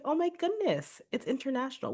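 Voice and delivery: high-pitched retold amazement; excited reaction. Podcaster retells hearing from overseas student, excited squeal-like pitch. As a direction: reenact delight, pitch way up.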